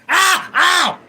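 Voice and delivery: high, loud yelps of sudden pain; real pain yelp. Real, not acted. Streamer suddenly hurt on stream, loud pained yelps. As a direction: cry out as if just scratched.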